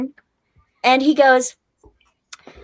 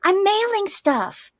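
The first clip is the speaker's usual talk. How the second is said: retold protest; 7 semitones higher than usual. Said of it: "I said".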